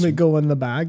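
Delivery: usual speaking voice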